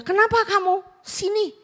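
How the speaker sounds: high-pitched retold summons; parent calling child. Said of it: "saya panggil dia".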